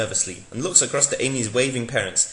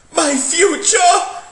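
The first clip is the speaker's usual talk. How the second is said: high, slow hammed-up wail, much louder; anguished cry; 9 dB louder, much slower than usual. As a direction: cry out in mock horror. Doctor's vision of Graham Norton.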